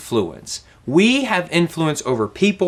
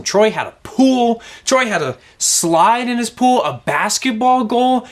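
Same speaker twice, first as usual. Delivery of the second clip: higher-pitched, excited run of wonders; excited listing; 6 semitones higher than usual. Real, not acted. Youth teacher raving to listeners about a friend's awesome house, excited. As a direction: build up each feature with excitement.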